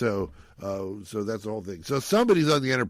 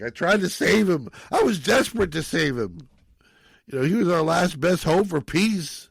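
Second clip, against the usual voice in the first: a little maudlin; 5 semitones higher, 5 dB louder than usual. Retold or imitated. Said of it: "a little maudlin".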